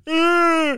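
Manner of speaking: long high droning "aah"; loud sustained mock groan; imitated loud moan. Retelling what they hear walking out singing, loud held moan.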